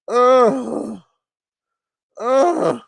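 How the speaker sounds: high, loud disgusted groan; disgusted ugh. Real, not acted. Reacting to the roast, loud disgusted ugh.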